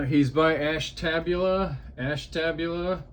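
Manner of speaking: ordinary talk, nothing marked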